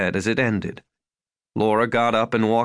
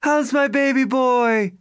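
doting mother; 13 semitones higher, slower than usual. Acted. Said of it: "Hi, Mom".

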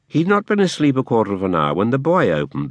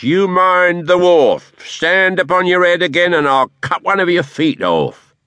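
much higher, louder snarling orders; commanding dwarf; 10 semitones higher, 5 dB louder than usual. A narrator's reading. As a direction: bark orders at the boy. Quilp orders the boy.

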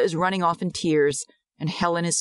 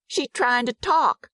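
put-on high, slow little-boy voice; small boy drawing out his answer; child's voice; 8 semitones higher than usual. A narrator's reading. Servant's son explaining the deaf girl, childlike.

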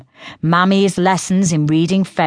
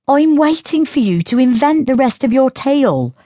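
put-on higher, light teasing girl's voice; girl Synth voice; 9 semitones higher than usual. A narrator's reading. Sister taunting Diello about his tale, in a higher teasing voice. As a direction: taunt him as the younger sister.

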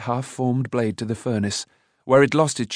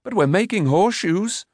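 high-pitched argumentative retort; objecting; 8 semitones higher than usual. A narrator's reading. Boy arguing about horseshoes, objecting. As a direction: press the point, voice raised in pitch. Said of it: "objected Taran".

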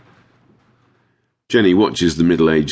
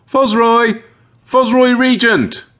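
high, loud call for a lost dog; high-pitched, drawn-out summons; 6 dB louder, slower than usual. A narrator's reading. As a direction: call the name across a field. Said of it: "calls out".

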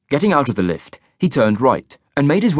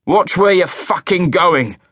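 angry shout; 7 semitones higher, slower than usual. A narrator's reading. Carlyle yelling at taxi.

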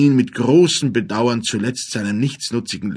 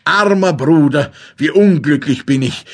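louder, mildly grieving outcry; tearful lament; 3 semitones higher, 6 dB louder than usual. A narrator's reading. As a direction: cry out in understated grief. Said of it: "rief mit Tränen in den Augen". (leicht klagender Ausruf)

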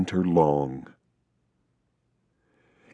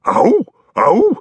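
high howling ow sounds; wolf howling; 13 semitones higher, 5 dB louder than usual. A narrator's reading. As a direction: howl like a wolf singing along. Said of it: "the wolf howled along with them".

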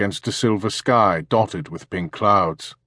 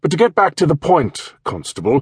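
throaty posh English major, raised and loud; upper-class English; 8 semitones higher, 4 dB louder than usual. Acted. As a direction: play a pompous retired English officer. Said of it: "in a throaty, upper-class English voice".

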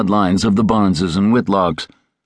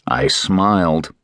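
ordinary delivery

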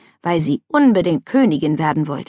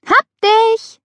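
very high, louder gotcha; triumphant blurt; 5 dB louder than usual. A narrator's reading. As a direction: burst out as she tags her. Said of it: "stieß Jasmin hervor".

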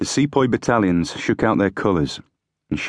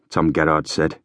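plain reading voice

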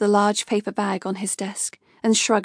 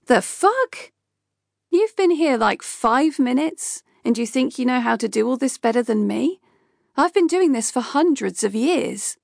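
much higher, indignant outburst; furious retort; 3 semitones higher than usual. Acted. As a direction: bristle at the upstart, stretching like five minutes. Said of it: "giving Kay a furious glare".

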